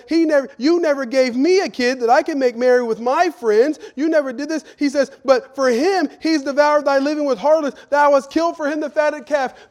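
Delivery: high, loud aggrieved protest; older brother's complaint. Acted. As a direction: throw the grievance at the father.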